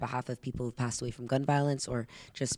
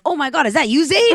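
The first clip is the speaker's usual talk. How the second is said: much higher and louder, laughing as it peaks; squealing surprise; 12 semitones higher, 13 dB louder than usual. Retold or imitated. Commenters spotting Zayd in the ad, high laughing squeal. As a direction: shriek it like a thrilled fan.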